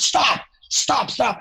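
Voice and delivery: high, sharp "stop, stop, stop" calls; abrupt stop call. Retold or imitated. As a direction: cut the band off sharply. Producer halts orchestra abruptly.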